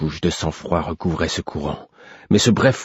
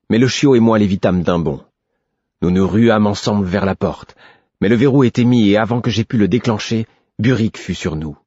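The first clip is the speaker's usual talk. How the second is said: ordinary delivery, nothing marked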